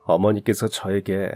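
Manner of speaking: plain reading voice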